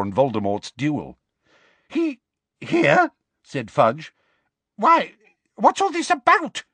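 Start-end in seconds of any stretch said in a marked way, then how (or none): 1.9-6.6 high, incredulous questions; astonished Fudge. Acted. Minister questions Dumbledore about Harry, high and incredulous. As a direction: demand an explanation in shock.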